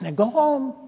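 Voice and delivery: high, mild imitation of a lady coaxing; coaxing dog owner. Elderly lady sending her little dog home, mild coaxing. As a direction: gently shoo a dog in a high voice. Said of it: "trying to get her dog to go home".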